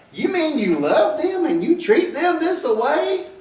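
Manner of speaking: high, laughing disbelief; incredulous. Retold or imitated. Preacher mocking false love with a high, laughing disbelief. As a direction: scoff at it as if it's absurd. Incredulous mock question in sermon.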